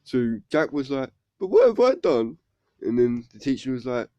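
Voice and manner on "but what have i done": high, loud pupil's protest; wronged schoolboy pleading his case; bewildered protest. Retold or imitated. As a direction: protest loudly in a jump of pitch. Pupil protests when sent to head.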